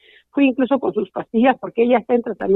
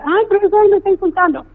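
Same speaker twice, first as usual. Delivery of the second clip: put-on whiny tattling girl; 9 semitones higher than usual. Mother imitates bullies tattling to the teacher, high mock whine.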